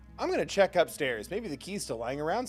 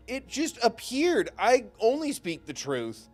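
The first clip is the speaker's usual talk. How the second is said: mock innocent; 12 semitones higher, 7 dB louder, slower than usual.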